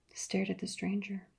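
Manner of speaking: ordinary delivery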